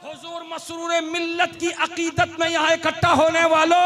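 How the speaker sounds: high, loud preaching call; full-force preaching. Acted. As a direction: rouse the whole crowd, loud and high. Preacher addresses the crowd.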